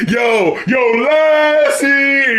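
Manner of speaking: high, loud hype call into the mic; hype shout. Retold or imitated. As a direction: shout it to kick off the take. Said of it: "he's just like".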